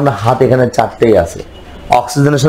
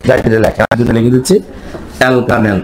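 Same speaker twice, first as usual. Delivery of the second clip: ordinary talk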